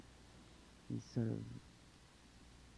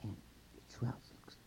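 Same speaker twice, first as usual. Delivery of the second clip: ordinary delivery, nothing marked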